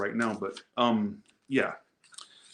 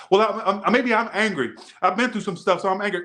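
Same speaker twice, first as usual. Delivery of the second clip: higher, mocking voice of a sulky contestant; 7 semitones higher than usual. Tyra mimicking the sulking contestant, higher and mocking. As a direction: parrot the girl's excuse back at her. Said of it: "yell at a girl like this".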